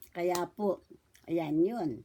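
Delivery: ordinary delivery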